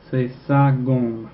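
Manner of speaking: very slow C'est sa gomme with sa stressed; very slow French model. Shown as a demonstration. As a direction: stretch out C'est sa gomme, leaning on sa. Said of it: "it's sa because the eraser is feminine".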